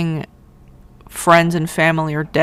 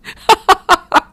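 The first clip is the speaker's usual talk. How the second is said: a high, loud laugh breaking out; real burst of laughter; 14 semitones higher, 6 dB louder than usual. Real, not acted. Laughing at the pilgrims telling off the king, high loud laugh. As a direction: laugh hard at your own joke.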